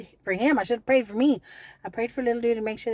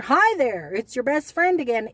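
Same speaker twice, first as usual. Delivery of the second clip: louder, sing-song fake friendly; mock taunting greeting; 4 semitones higher, 6 dB louder than usual. Prank caller greeting her again, mock sugary.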